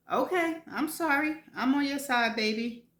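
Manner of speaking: placating. Acted. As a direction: back off fast, calm her down. Friend backs off, placating.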